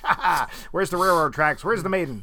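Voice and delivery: hammed-up melodrama villain laugh; mustache twirling villain. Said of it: "I twirl my mustache".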